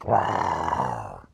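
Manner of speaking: low, slow growling roar; lion roar. Retold or imitated. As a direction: growl like a face-eating lion. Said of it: "face-eating lion dog".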